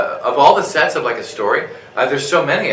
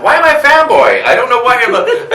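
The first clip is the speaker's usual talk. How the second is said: high, loud, hammed-up fanboy panic; laughing, over-the-top fanboy fluster; 12 dB louder than usual. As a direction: wail it in starstruck bewilderment. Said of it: "I'm like a fanboy".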